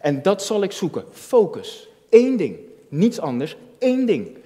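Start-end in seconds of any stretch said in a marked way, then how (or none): 2.1-2.6 Eén shouted high and loud; loud fervent Eén ding; stress on één. Acted. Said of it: "preaching emphasis". 3.8-4.4 Eén shouted high and loud; loud fervent Eén ding; stress on één. Acted. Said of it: "preaching emphasis".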